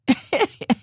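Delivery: high burst of laughter. Real, not acted. Laugh after quoting lady.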